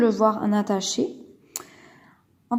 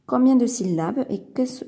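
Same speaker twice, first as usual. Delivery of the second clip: ordinary talk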